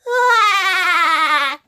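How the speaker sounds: loud, high, rapidly wobbling laugh; SpongeBob. Shown as a demonstration. Streamer doing impressions, SpongeBob laugh. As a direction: let out a long high trilled laugh. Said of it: "SpongeBob laugh".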